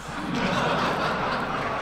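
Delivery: breathy laugh at own joke. Real, not acted. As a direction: laugh at the punchline. Laugh after own punchline.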